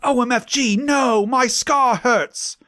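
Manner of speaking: high, shrill-pitched squeal of pain; hammed-up scream. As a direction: shriek it up high, drawing out scar slowly. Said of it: "he started to scream".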